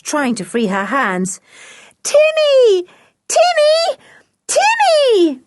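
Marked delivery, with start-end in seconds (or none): 2.0-5.4 very high, urgent repeated yells; shouted calls. A narrator's reading. George struggling with bound hands, shouting for Timmy. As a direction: cry out high and loud for rescue. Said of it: "shouted George".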